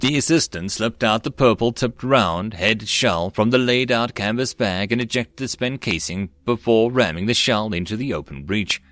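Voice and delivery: plain reading voice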